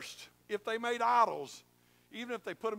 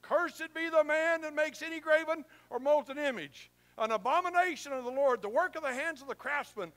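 higher, louder, proclaiming the curse; loud proclamation; 6 semitones higher, 5 dB louder than usual. Acted. Preacher reading the Levites' curse aloud to the congregation, loud proclamation. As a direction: call it out like a Levite to the crowd. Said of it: "speak and say ... with a loud voice".

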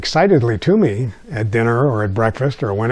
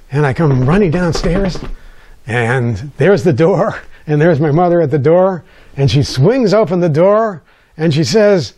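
normal talking voice

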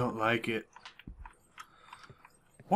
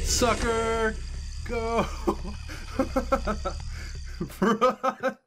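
high, thrilled taunting as she runs; gleeful panic while fleeing; excited taunt; 8 semitones higher, much slower than usual. Real, not acted. Escaping the monster.

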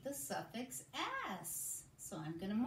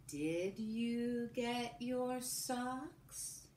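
very slow and even; slow choral dictation; 3 semitones higher, much slower than usual. Shown as a demonstration. Teacher repeating the sentence with the class, very slow. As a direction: say it together, word by word. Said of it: "Say it with me".

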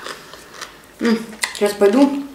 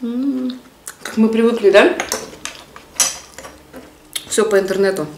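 chewing while talking; talking with food in mouth; mouth full. Real, not acted. Seen 4: pasta forked in at -2.0; full cheeks, chewing while talking.

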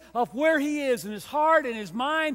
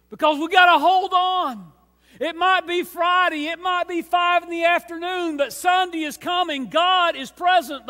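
loud, high, ringing preacher's cry; full-force preaching; 6 semitones higher, 7 dB louder than usual. Acted. Preacher rallying the church toward Sunday, loud high cry. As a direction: lift the congregation with it.

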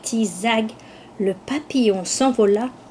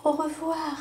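much softer echo of the goodbye; fading goodbye; 5 semitones higher, 7 dB quieter than usual. A narrator's reading. Butterfly's last goodbye to the lamb from far off, soft. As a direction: let it drift away into the distance.

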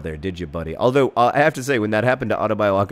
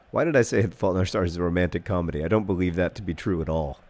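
usual speaking voice

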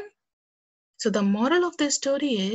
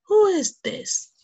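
high, drawn-out angry yell; angry scream; 9 semitones higher, 6 dB louder, slower than usual. Acted. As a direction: cry out loudly as an outraged lion. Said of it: "He got very angry and screamed".

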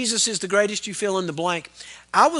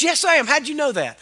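imitated spunky reply, higher and louder; spunky woman; 7 semitones higher, 3 dB louder than usual. Phlebotomist answering the pastor on the blood bus, spunky imitation. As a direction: pipe up like a delighted, feisty lady. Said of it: "She was kinda spunky".

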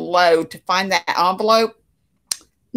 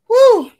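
loud whew; 9 dB louder than usual. Real, not acted. After a laughing fit.

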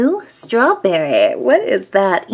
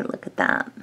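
whispering; 8 dB quieter than usual. Real, not acted. Squishy review, whispering close.

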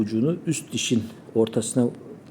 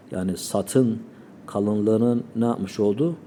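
ordinary talk, nothing marked

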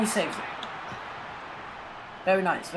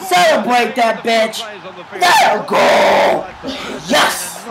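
goal celebration shout; slower than usual. Real, not acted. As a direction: scream with joy at the goal.

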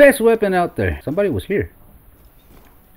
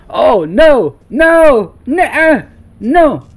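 panicked cries; 6 semitones higher, 7 dB louder, much slower than usual. Real, not acted. Panic during chase.

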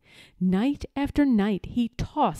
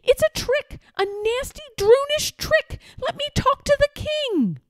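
put-on much higher, pleading boy voice; panicked plea to reach the king; desperate boy. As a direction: page boy being dragged away, high and desperate. Boy protests being seized.

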